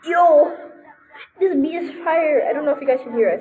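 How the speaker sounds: high, loud hype; real hyped outburst; excited shout. Real, not acted. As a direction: call it out thrilled. Excited by game music.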